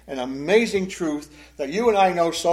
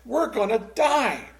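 imitating panic; 8 semitones higher than usual. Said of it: "They're scared to death".

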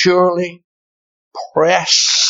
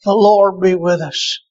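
fiery preaching; 9 semitones higher than usual. Acted. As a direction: pound the congregation with it. Preaching at full force.

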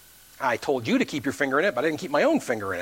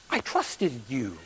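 low, slow, softer acted complaint; hurt reproach; 6 semitones lower, 5 dB quieter, slower than usual. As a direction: let it sink into wounded disappointment. Drowned man reproaches God in heaven.

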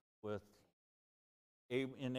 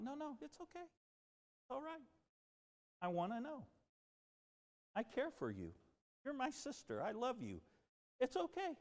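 gentle reassurance; 11 semitones higher than usual. Retold or imitated. Comforting the apologetic lady.

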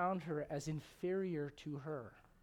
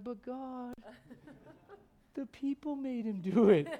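giggling whiny excuse with laughter breaking in; laughing through a lame excuse; mock excuse; 7 semitones higher, 5 dB louder, slower than usual. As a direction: play the guilty kid blaming others. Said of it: "I'm like".

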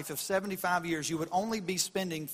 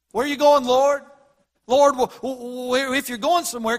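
anxious plea; 5 semitones higher, 8 dB louder than usual. Acted. Disciples pleading with Jesus.

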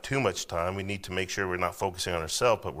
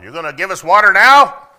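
high, loud mocking demand; demanding Israelites; 12 semitones higher, 21 dB louder than usual. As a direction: sneer it like a whining, demanding crowd.

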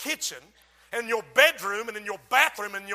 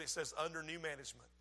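soft, fast, offhand reading of the sign; quiet quick punchline; 5 semitones lower, 14 dB quieter, faster than usual. Acted. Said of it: "He said".

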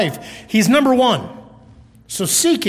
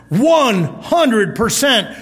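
slow, drawn-out one hundred percent; slow emphasis; 2 semitones higher, much slower than usual. Real, not acted. Preacher slowly stresses one hundred percent to the church. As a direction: lay out each word slowly. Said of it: "slow emphasis".

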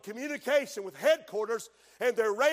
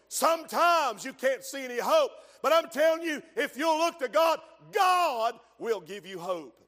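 shouting hope to the congregation; preaching at full force; 3 semitones higher, 4 dB louder than usual. Acted. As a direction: lift them with a cry of hope.

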